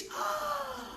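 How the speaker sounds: long, high gasp of amazement; acted sharp intake of astonishment; shocked gasp. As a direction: gasp in sudden realisation. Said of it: "suddenly got shocked".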